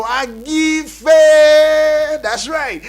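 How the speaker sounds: high, loud hype shout; loud greeting to the people of Isoko; excited shout. Real, not acted. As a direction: shout it out to the listeners. Greeting in dialects, hyped.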